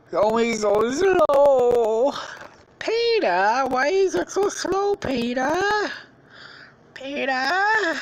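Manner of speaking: high, loud, drawn-out whine for Peter; mock exasperated calling. Calling out to Peter over the slowness, exasperated and high. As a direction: stretch out the complaint in a high voice.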